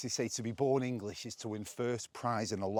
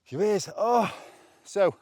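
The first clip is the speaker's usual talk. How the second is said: loud, higher gasping words after the climb; winded from climbing the hill, blurting louder and higher; out of breath; 8 semitones higher, 9 dB louder, slower than usual. Real, not acted. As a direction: puff the words out between breaths. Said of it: "I'm feeling a bit out of breath".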